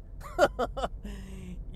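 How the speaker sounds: long, rippling run of chuckles; chuckling laugh. Real, not acted. Podcaster amused by own skateboard line, chuckling laugh. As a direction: laugh it out in a quick string of ha-has. Signature laugh before his catchphrase.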